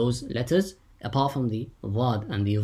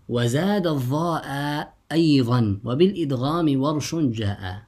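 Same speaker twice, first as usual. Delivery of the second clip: louder, lilting recital of a teaching poem; verse recitation; 4 semitones higher, 4 dB louder than usual. Shown as a demonstration. Teacher recites the author's line to students, measured verse.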